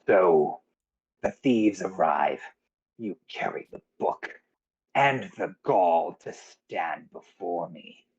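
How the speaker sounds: slow, low villain voice, drawn out; menacing cult leader. Acted. Game master as cultist confronting arriving thieves, slow menacing role voice. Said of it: "Ulrich sees you as".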